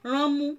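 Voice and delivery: Ránmú said high with the nose squeezed; squeezed-nose demo. Shown as a demonstration. Teacher showing students how to squeeze the nose. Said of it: "squeezing my nose".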